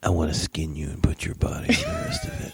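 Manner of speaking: high, breathless, menacing whisper; creepy killer whisper. Pretend. Joking about a psycho reply to the photo, creepy whisper.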